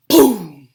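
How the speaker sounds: big loud thunder boom. A narrator's reading. Reader booms out the thunder for kids. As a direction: belt out the thunderclap.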